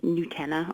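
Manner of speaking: Nutella said as noo-TELL-a; long oo opening on Nutella; foreign pronunciation. Shown as a demonstration. Guest rejecting the official version, noo-TELL-a for Nutella. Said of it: "not gonna start calling it".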